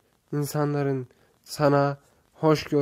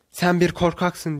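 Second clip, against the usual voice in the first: louder, mildly angry narrated shout; 3 semitones higher, 6 dB louder than usual. A narrator's reading. Boy angered by the reply, mild angry shout. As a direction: hurl the accusation with some force. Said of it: "diye bağırır".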